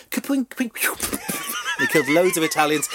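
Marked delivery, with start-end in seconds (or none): 0.1-1.5 very high, slow drawn-out kapwing; longbow twang noise. Retold or imitated. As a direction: twang it like arrows flying off the string. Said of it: "the longbowmen just went kapwing".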